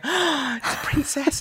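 put-on slow, high, wonder-struck child voice; lightly voiced awed child. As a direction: mimic a dazzled little kid. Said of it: "children were going".

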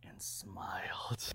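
very slow, whispered punchline; sinister hush. Real, not acted. Storyteller confesses reaction to teacher's death, hushed and sinister. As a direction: drop to a slow whisper for the creepy reveal.

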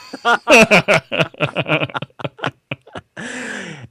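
high, drawn-out real laughter; long hearty laugh. Real, not acted. Laughs at his own answer.

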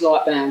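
Lightbound said with no R after the L; name said correctly. Shown as a demonstration. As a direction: say Lightbound as light-bound, no R sound. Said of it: "a lot of people put the R in there".

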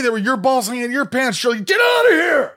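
high, loud protest shouted back; indignant yell. Retold or imitated. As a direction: yell back at dad in disbelief. Kid yelling back at his dad.

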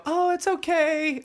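bright high falsely cheerful "it's okay"; breezy high reassurance. Retold or imitated. Mimicking a comforter's tone to a crying man, light and high. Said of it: "their tone of voice".

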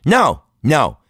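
loud, high, separate refusals; firm mom scolding. Retold or imitated. Mom to grabbing kid, loud firm scolding. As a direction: imitate a mom stopping a child short.

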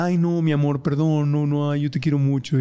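imita a la pareja arrepentida que suplica; pleading apology. Retold or imitated. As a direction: ruega perdón con tono meloso. Quoting apologetic partner after outburst. (pareja arrepentida pidiendo perdón, suplicante)

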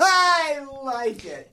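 high, drawn-out words through laughter; delighted laughter. Real, not acted. Friend enjoying the story of the insult, real delighted laughter. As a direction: squeal it slowly while laughing.

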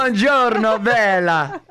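high, hammed-up Italian accent. Host greets a beauty, hammed-up Italian accent. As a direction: flamboyant mock Italian greeting.

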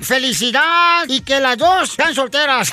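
loud, booming holiday announcer; radio promo. Acted. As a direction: sell the greeting to the whole audience. (locutor de radio, fuerte y festivo)

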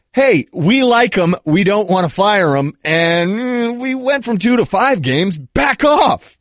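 high-pitched, drawn-out defiant stand; mock defiance. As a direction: stand your ground, ending on a high, shouted push-back. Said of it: "rooting for Ohio State's defiance".

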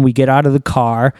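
normal talking voice

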